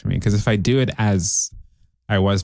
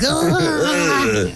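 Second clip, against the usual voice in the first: disturbed gasp. Retold or imitated. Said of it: "she'd be like".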